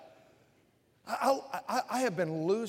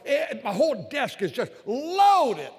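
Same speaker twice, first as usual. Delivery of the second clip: emphatic; 6 semitones higher, 8 dB louder, slower than usual. Real, not acted. Preacher exclaims desk is loaded.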